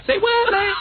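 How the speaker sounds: playing stupid. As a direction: squeak it out like a clueless kid putting it on. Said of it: "you have to talk stupid".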